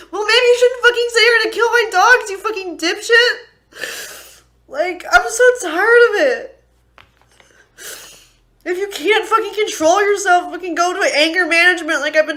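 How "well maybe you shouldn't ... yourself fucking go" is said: much higher, louder, tearful yelling; real furious crying at the husband; crying rage. Real, not acted. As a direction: scream through tears at him. Screaming at husband while crying.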